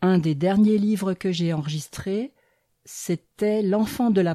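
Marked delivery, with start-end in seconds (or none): none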